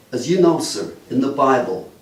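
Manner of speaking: usual speaking voice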